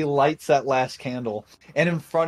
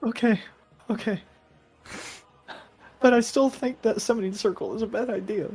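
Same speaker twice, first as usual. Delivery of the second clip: timid Dremen; 9 semitones higher than usual. Acted. NPC keeps voice.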